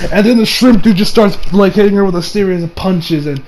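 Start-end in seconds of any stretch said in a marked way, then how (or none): none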